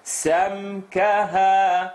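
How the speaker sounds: سمكها slow, the meem held without pressing; committed meem. Shown as a demonstration. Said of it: "commitment to the meme".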